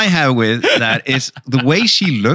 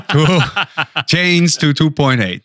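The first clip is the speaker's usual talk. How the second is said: laughing; 5 semitones higher, slower than usual. Real, not acted. Laughing through prediction.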